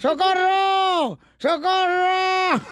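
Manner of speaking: high, long-drawn cries for help; comic wailing holler; acted shouting. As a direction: yell from inside a house, holding the last vowel. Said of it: "¿Por qué está gritando".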